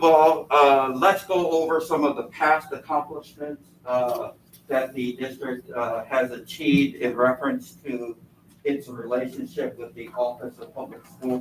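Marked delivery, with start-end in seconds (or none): none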